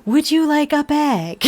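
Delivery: slow, pleasant automated cashier; robot voice. Retold or imitated. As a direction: ask it like a checkout machine. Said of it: "I just started mimicking it".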